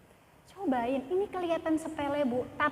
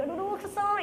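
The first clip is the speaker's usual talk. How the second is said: high-pitched mock whine of annoyance; acted exasperation; 6 semitones higher than usual. Wife vents about her husband coming home, mock exasperation.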